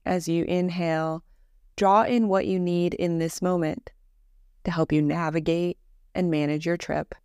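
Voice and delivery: calm, measured meditation voice with long pauses; guided meditation. Acted. Teacher leading a listener through Yoga Nidra breathing, guided meditation voice. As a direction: guide them gently into rest, evenly and unhurried.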